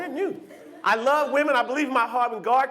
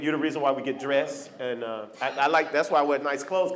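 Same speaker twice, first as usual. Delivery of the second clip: ordinary delivery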